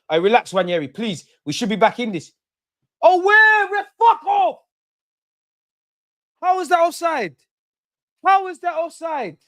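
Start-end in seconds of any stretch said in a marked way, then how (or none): 3.0-4.6 screaming at the ref, very high and loud; furious shout. Real, not acted. Fan raging at the ref over a call, screaming. Outraged at referee decision. 6.4-9.4 high, exasperated cry; outraged disbelief. Real, not acted. Fan protesting the offside to the screen, outraged.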